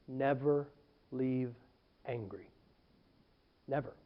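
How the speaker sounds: low, very soft, word by word; hushed warning. Real, not acted. Pastor telling how to leave a church, hushed warning. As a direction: drop right down and pause after each word. Delivers the rule in a hush.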